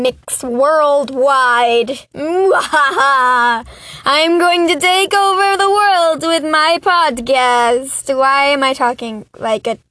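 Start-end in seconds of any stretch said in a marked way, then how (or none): 2.1-8.2 higher, hammed-up Transylvanian vampire; terrible Transylvanian vampire accent. Podcaster plotting world domination, in mock Transylvanian vampire accent. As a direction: play a villainous vampire with bad Transylvanian accent. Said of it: "like a vampire with a terrible Transylvanian accent".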